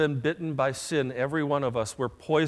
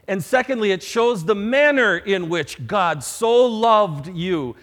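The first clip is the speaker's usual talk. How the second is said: rising preaching; 4 semitones higher, 9 dB louder than usual. Acted. Preaching climax.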